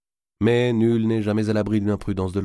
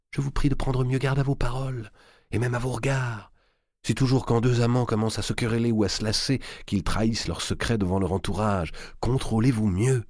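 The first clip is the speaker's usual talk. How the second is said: a hint of a higher, cool queen's rebuke; lightly voiced queen; 5 semitones higher than usual. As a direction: warn him coolly to guard himself. Queen scolding lover.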